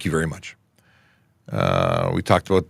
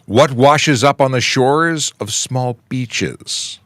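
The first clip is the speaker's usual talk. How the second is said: slow, higher, louder riddle reading; slow joke setup; 8 semitones higher, 7 dB louder, much slower than usual. Acted. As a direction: stretch the setup for suspense.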